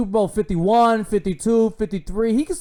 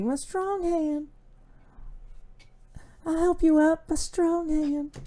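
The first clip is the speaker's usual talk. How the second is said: high, slow, eerie movie villain voice; creepy movie voice; 10 semitones higher, slower than usual. Retold or imitated. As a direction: drawl it out high and slow like a creepy horror character. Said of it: "J P P be like".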